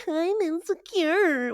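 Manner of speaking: high, drawn-out caricature of insecurity; mock insecure. Friend mocking her own social nerves, exaggeratedly insecure. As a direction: stretch it out in a high put-on insecure voice. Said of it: "Don't go in being like".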